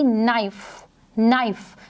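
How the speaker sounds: "नाइफ" said with no k sound, starting on n; silent k. Shown as a demonstration. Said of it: "वो sound क्या थी?". ("नाइफ" बिना क के)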